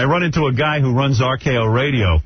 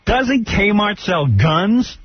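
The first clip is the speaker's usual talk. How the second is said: slow pointed question; 7 semitones higher, much slower than usual. Real, not acted.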